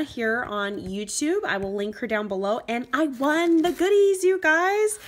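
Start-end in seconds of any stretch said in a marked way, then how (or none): 2.7-5.0 high, loud, bursting with excitement; thrilled squeal. Real, not acted. Vlogger tells her viewers she won, loud excited squeal. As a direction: blurt out the win in delight.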